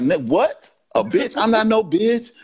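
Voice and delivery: bristle, shocked at being called that; offended partner. Acted. Partner recoils at a blunt insult, indignant and rising. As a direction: pitch jumps up in indignant disbelief.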